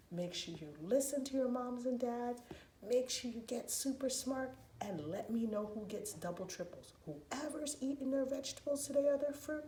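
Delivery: ordinary delivery, nothing marked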